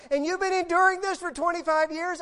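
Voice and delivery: loud, high-pitched disbelieving question; incredulous husband. Retold or imitated. Husband incredulous at wife's confession.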